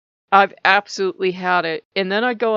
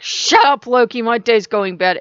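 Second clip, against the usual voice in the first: louder, higher, a playful mock snap; retold angry snap; 3 semitones higher, 8 dB louder than usual. Retelling snapping at Loki over the insurance, a loud mock snap. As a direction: bark it back at him, half laughing.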